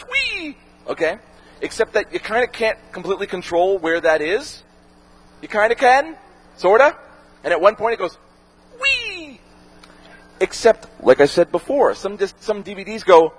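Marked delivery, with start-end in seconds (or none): 0.0-0.6 high squealing whee; a gleeful high-pitched wheee; laser's whee. Pretend. Disc laser voiced switching layers. 8.8-9.4 high squealing whee; a gleeful high-pitched wheee; laser's whee. Pretend. Disc laser voiced again.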